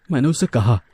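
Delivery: ordinary delivery, nothing marked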